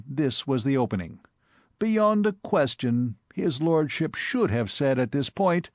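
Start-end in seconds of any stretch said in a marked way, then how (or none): none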